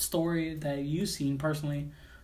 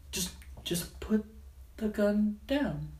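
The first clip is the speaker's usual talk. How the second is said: calm hostage negotiator; 4 semitones higher than usual. Retold or imitated. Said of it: "they'll be like".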